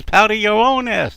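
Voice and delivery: loud, high-pitched bickering wife; indignant retort. Acted. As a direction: fire back at the husband. Wife retorting in acted quarrel.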